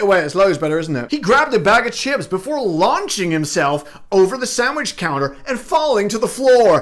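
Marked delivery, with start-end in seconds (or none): 1.1-6.8 high, punchy American news anchor; Fox News presenter. Shown as a demonstration. Host parodies a Fox News presenter, sensational anchor voice. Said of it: "in the style of a Fox News presenter".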